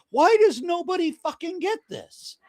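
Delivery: very high-pitched frustrated outburst; real exasperation. Real, not acted. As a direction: let the frustration burst out, pitch shooting way up. Exasperated outburst at not being understood.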